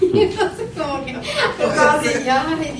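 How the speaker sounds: laughing. Real, not acted. Woman laughing at her own awkward invitation while telling it. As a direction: giggle the words out, slowing down.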